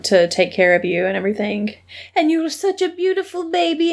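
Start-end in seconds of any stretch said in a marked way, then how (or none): 2.1-3.9 lightly put-on French accent; a hint of French, badly done. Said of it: "That was your New York accent?".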